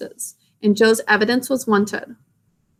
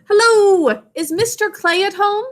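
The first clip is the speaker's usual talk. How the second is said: shouted call; 9 semitones higher than usual. A narrator's reading. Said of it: "and shouted".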